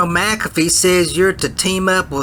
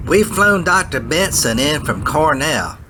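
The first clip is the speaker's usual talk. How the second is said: ordinary talk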